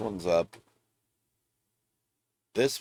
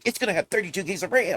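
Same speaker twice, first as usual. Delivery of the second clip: mock hyped fan; 10 semitones higher, faster than usual. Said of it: "everybody's like".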